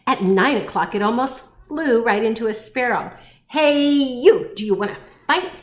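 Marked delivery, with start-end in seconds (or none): 3.5-5.5 loud hail, starting high; grouchy challenge. A narrator's reading. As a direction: pick a fight like a scrappy ladybug. Grouchy ladybug challenges sparrow.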